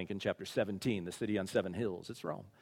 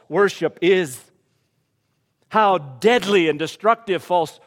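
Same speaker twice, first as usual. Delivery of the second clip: loud, raised pulpit preaching; fired-up preacher; preaching at full force; 6 semitones higher, 12 dB louder than usual. Acted. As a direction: drive it home to the congregation.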